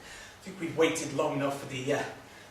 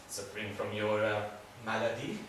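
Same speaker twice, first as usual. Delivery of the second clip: put-on softer line in a French accent; 6 semitones lower, 7 dB quieter than usual. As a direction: repeat it with a French accent, quietly. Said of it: "I love a good accent".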